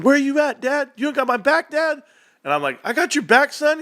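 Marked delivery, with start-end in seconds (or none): 0.0-2.0 high-pitched son yelling at Dad; pleading, accusing kid yell, pitched way up; imitated yell. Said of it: "your son yelling at you". 2.8-3.8 high, hurried reassurance to son; acted-out dad yelling over a game; mock shouting back.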